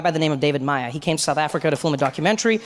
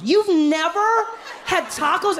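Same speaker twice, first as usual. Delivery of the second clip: very slow, high-pitched incredulous question; mock disbelief; much slower than usual. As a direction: stretch every word in exaggerated shock. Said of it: "they can't believe you haven't had".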